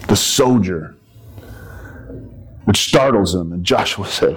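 normal talking voice

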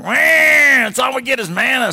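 wailing complaint; whiny Israelites. Retold or imitated. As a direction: whine like a spoiled child about the manna. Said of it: "And they're like".

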